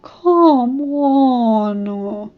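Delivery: whining. Acted. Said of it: "Rainbow whined softly".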